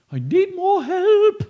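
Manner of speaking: high, loud, slow ghostly plea; ghost asking for help. Acted. Ghost asking for help, ghostly plea. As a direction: plead like a ghost. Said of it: "said the ghost".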